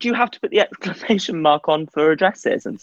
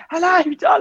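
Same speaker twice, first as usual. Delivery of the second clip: screaming; 12 semitones higher, faster than usual. Pretend. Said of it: "Oh, screaming".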